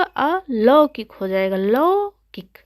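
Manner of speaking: slow अलौकिक with a drawn-out औ; teacher spelling out the sound; correct pronunciation. Shown as a demonstration. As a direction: lean into the लौ sound slowly. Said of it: "अशुद्ध है".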